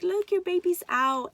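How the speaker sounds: high, loud imitated announcement; excited doctor. Doctor showing mother her newborn, imitated excited call. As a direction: call it out high, retelling the big news.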